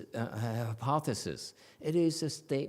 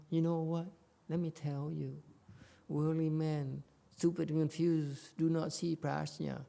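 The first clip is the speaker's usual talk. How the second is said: softer, slightly raised, tentative voice; hesitant soft-pedalling; 4 semitones higher, 3 dB quieter than usual. Shown as a demonstration. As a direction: break it gently, as if hedging. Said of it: "It's not like".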